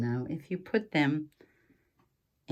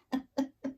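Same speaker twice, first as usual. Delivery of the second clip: a quick, high little giggle; brief amused giggle; short giggle; 8 semitones higher, 4 dB quieter than usual. Real, not acted. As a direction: let a short giggle slip out. Laughs after the secret.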